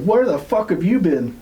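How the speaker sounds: high-pitched retold outburst; indignant demand. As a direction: imitate exasperated friends, pitch jumping up. Friends confront the returning man.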